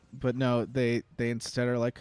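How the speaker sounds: ordinary talk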